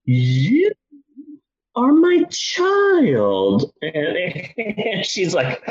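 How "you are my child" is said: very slow, halting, dazed delivery; stoned dad. Retold or imitated. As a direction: space out each word like a dazed robot. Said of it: "I go like this".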